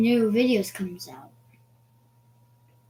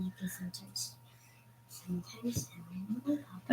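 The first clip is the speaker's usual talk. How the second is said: whispering; 3 semitones lower, 10 dB quieter, faster than usual. Real, not acted. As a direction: breathe the words quietly. Child whispering near mic.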